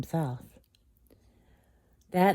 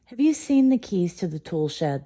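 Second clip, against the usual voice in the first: demanding; 5 semitones higher, 6 dB louder than usual. A narrator's reading. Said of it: "the beast demanded".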